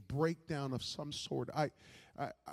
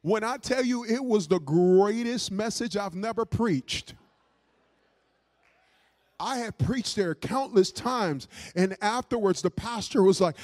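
loud, raised testimony to the congregation; emphatic preaching; 4 semitones higher, 8 dB louder than usual. Real, not acted. Preaching at full force.

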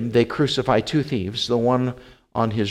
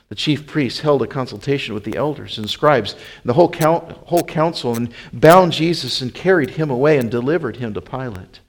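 plain reading voice

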